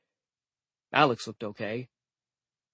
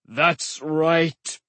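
mocking giant; 3 semitones higher, 4 dB louder than usual. A narrator's reading. Giant confirms.

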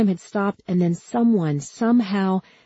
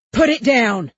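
high, loud police command; shouted order; 5 semitones higher, 6 dB louder than usual. A narrator's reading. Cop to armed girl, shouted order. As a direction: yell it as an urgent order.

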